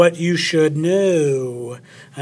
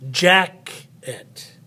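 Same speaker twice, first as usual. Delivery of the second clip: "Jacket" with a strong JACK, a gap, then a lighter "et"; stress on first syllable. Shown as a demonstration. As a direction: punch JACK, let "et" fall away in "Jacket". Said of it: "it's more loud than the other part".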